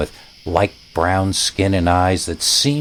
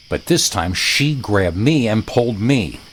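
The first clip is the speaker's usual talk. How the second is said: ordinary delivery, nothing marked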